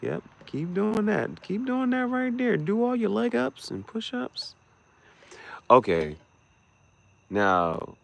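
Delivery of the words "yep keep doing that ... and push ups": much higher, coaxing and cheering on. Real, not acted. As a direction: egg them on in a high sing-song. Coaxing someone, high voice.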